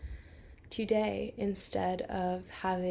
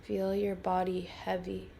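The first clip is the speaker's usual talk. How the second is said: calm, unhurried guiding voice with long pauses; guided meditation. Real, not acted. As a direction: lead a listener gently into rest, phrase by phrase.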